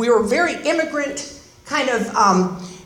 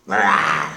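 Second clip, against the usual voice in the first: very low snarling growl; tough-guy growl. Acted. Describing her violent Texan stepfather, low growl. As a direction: growl it like a brawler.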